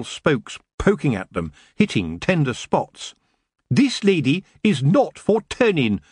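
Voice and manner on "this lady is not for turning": higher, emphatic declaration; booming. A narrator's reading. Missus Earwig defies the elves, booming. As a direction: declare it firmly with raised pitch. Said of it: "Missus Earwig boomed".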